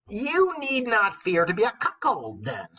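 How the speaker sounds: high, loud mock old woman; old wife reading. The old wife teases her husband, high and loud. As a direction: lecture him like a sly old crone. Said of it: "old wife's lines read aloud".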